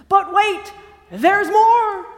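excited pitchman. Acted. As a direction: sell it big like a TV pitch. Infomercial line, later repeated hushed.

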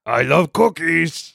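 slow growly monster voice; slow puppet monster craving cookies. Pretend. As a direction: savour each word like a hungry monster. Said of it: "What's his tag, tagline?".

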